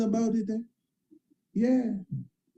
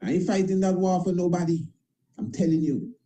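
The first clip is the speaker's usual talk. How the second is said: ordinary delivery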